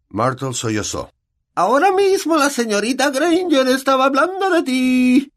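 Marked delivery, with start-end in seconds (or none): 1.5-5.3 high, louder, spiteful tattling; malicious. A narrator's reading. Peeves tattling into Myrtle's ear, malicious. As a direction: stir up trouble with relish. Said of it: "dijo Peeves a Myrtle al oído maliciosamente".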